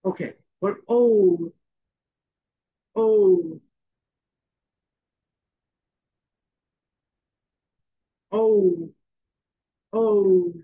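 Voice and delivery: oh with lips in a round shape, drawn out slowly; slow, rounded oh for the class. Shown as a demonstration. Teacher to class, modelling a round-lipped oh slowly. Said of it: "round shape".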